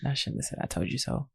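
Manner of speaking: whispered, apologetic; sheepish whisper. Real, not acted. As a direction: whisper it, embarrassed. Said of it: "I'm sorry".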